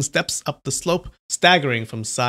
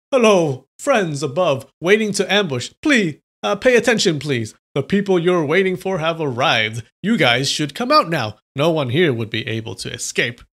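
shouting very loudly; 3 semitones higher, 4 dB louder than usual. Acted. Swordsman warning the hidden ambush, loud calling out. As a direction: call out up the cliff to the ambushers. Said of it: "shouted very loudly".

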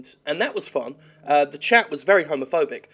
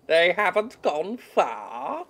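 slow, drawn-out character voice; imitating Witch Hunter; 3 semitones higher, much slower than usual. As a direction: copy the game's witch hunter. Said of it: "That was my character".

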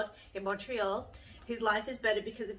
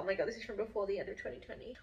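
ordinary talk

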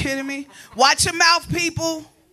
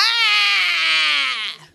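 disgusted gag cry; 3 semitones higher than usual. Retold or imitated.

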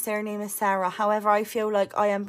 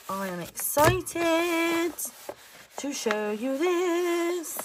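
higher and very slow, building suspense; drawn-out tease; 6 semitones higher, much slower than usual. Acted. Teasing viewers before revealing new stickers, slowly. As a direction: stretch each word to tease the reveal.

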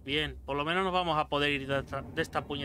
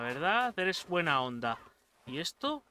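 normal talking voice

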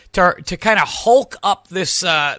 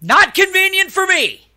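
higher, louder snapped reply; indignant retort; 12 semitones higher, 4 dB louder than usual. Retold or imitated. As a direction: throw it back at her. Retold angry retort to scheduler.

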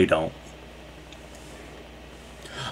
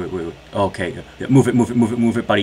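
normal talking voice

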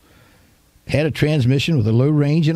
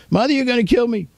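very high, piercing retold scream; highest scream; 12 semitones higher, faster than usual. Boy screaming at the top of his lungs during a spanking. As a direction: scream it as high as you can. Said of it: "top of my lungs as high, loud as I could scream".

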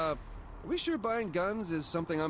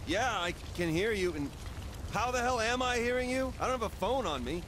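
raised, high-pitched voice; pitched up, projecting to an unseen caller; yelling; 9 semitones higher than usual. Acted. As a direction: call out as if the listener can't hear you. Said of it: "you don't have to yell".

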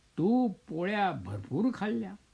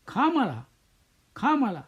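bold challenge; 6 semitones higher, 3 dB louder than usual. A narrator's reading. Old woman bold to beasts.

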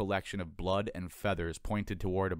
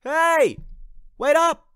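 much higher, loud yell; loud call; 13 dB louder, much slower than usual. Acted. Anthony hailing the stranger gull, a loud high yell. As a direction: shout after someone flying off. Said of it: "as loud as he could".

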